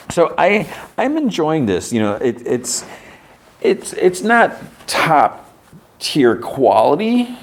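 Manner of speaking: high, drawn out enthusiasm; animated review. Real, not acted. Reviewer reacting to the episode, high animated delivery. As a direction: gush about the show.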